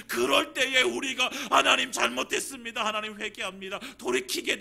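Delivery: loud, building sermon appeal; rally the congregation; fervent preaching. Acted. Preaching at full force. (열정적으로 고조되며 설교하듯)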